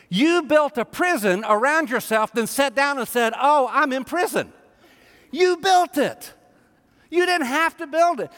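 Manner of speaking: high, emphatic preacher's delivery; impassioned preaching. Real, not acted. Preacher confronting the congregation about their self-labels, impassioned and emphatic. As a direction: drive each point home to the congregation, pitched high.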